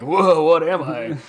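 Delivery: confused newborn voice. Retold or imitated. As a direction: play a dazed creature just born. Newborn queen confused in orientation.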